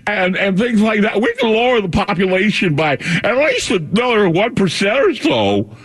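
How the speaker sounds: high, hesitant, slow mock voice; Bill Gates impression. Radio host imitating Bill Gates to listeners, high halting mock. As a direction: drag it out high and halting, mocking him. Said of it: "this is how he sounds".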